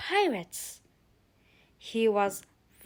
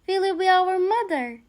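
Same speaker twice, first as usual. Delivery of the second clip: shouting; 7 semitones higher, 6 dB louder than usual. Acted. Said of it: "they shouted".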